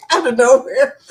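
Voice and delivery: laughing high and loud; laughing repeat. Real, not acted. Amused at her accent coming back, laughing. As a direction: laugh the phrase out again.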